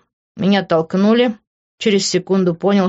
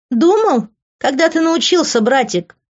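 very high, mocking echo; smug, high-pitched taunt; smirking; 10 semitones higher than usual. A narrator's reading. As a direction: jeer with a smirk in the voice. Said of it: "ухмыльнулась она".

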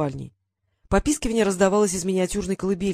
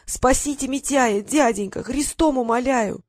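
higher, slow wailing plea; 5 semitones higher, slower than usual. A narrator's reading. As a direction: wail and beg on her knees. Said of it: "заголосила".

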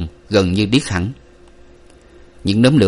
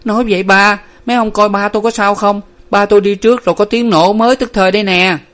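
high, louder anxious pleading; frightened young woman, pitched up; trembling plea; 11 semitones higher, 4 dB louder than usual. A narrator's reading. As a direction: plead urgently for news of your father. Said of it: "Cô lập cập lên tiếng".

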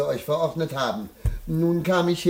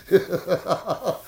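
mocking laugh. Husband jokes about his reluctant wife, laughing. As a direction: laugh heartily at your own jibe.

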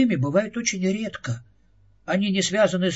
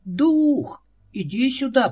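raised voice, calling across the room; calling out loudly; 8 semitones higher, 4 dB louder, slower than usual. A narrator's reading. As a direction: call the spirit out, loud and high. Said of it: "Он возвысил голос".

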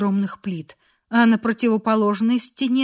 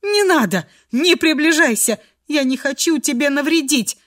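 high, louder plea in despair; desperate sobbing plea; 4 semitones higher, 4 dB louder than usual. A narrator's reading. Girl fears harming the man, desperate plea. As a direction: beg him off desperately, high and urgent. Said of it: "всхлипнула в отчаянии Фрея".

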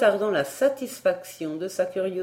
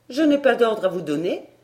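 higher, louder, sour retort; tartly; 5 semitones higher, 6 dB louder than usual. A narrator's reading. Barbara snubbing the tutor, tartly. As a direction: snub him with a raised voice. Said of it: "avec aigreur".

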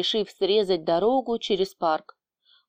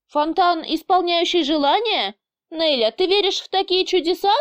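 higher, incredulous questioning; sceptical scoff; 9 semitones higher than usual. A narrator's reading. Boss doubts a wish-granting fountain, sceptical. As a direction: question it with mocking disbelief. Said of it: "скептически усмехнулся босс".

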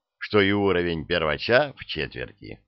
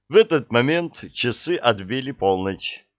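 ordinary delivery

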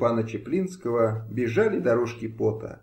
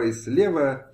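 usual speaking voice

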